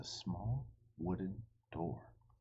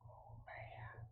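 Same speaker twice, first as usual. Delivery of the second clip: whispered dread; 19 dB quieter than usual. Real, not acted. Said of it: "reader reacts".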